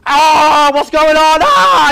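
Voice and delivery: loud mock panic cries; alarmed yell. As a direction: burst out as if startled by a crash. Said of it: "when he's alarmed".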